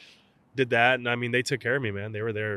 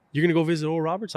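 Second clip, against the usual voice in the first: disbelieving coach; 8 semitones higher, 6 dB louder, faster than usual. Retold or imitated.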